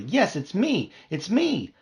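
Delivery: high eager yes it's me; excited. Acted. Bee overjoyed that his sister noticed him, excited. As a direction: insist with delight. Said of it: "I cried excitedly".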